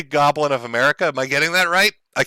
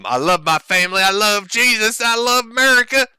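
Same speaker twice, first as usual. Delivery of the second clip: higher and higher, hammed-up pious patriot; mock family-values guy; 7 semitones higher than usual. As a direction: parody a preachy family man, rising with each line. Mocking Ralph's family-values act.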